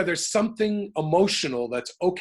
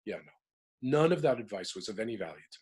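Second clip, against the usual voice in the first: ordinary talk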